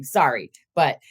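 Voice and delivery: usual speaking voice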